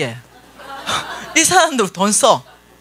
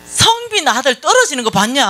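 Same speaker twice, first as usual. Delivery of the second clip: higher-pitched retold taunt; needling boast; 6 semitones higher than usual. Friend boasting about her son to rub it in, needling. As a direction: rub it in, voice lifted. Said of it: "염장을 확 질러버리는 거야".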